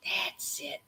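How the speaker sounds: soft whispered praise. Real, not acted. Teacher praising kids, a soft whisper. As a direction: breathe it to the class. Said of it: "praising class pantomime".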